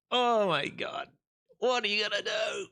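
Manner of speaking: high-pitched, loud exasperated wail; exasperated outcry. Real, not acted.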